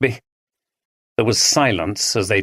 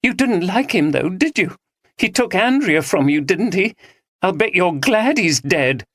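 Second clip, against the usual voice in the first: high accusing jab; hostile; 9 semitones higher than usual. A narrator's reading. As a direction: accuse him bitterly. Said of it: "gave him a hostile look".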